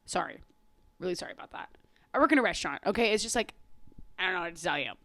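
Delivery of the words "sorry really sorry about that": softer, breathy aside; whispered apology. Real, not acted. Apologizing to viewers for swearing, whispered. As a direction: whisper the sorry like a guilty aside.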